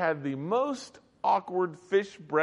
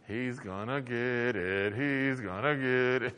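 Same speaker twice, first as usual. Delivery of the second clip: gloating whisper; 5 semitones lower, 5 dB quieter, slower than usual. Retold or imitated. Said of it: "they're going".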